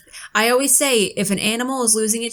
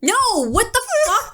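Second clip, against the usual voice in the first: high-pitched, appalled outburst; appalled disbelief; 13 semitones higher than usual. Real, not acted. Co-host hears the woman waved at them, appalled disbelief. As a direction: blurt it out high in sheer disbelief.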